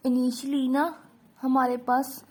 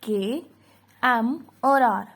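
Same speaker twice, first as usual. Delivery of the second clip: slow emphasis; slower than usual. Shown as a demonstration. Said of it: "हमेशा W लगेगा के".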